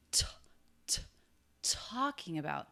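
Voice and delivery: t in talking released with an extra puff of air; breath burst on the first sound of talking; aspirated true T. Shown as a demonstration. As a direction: let air escape on the T of talking. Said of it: "lets a little bit of extra air escape on his true T".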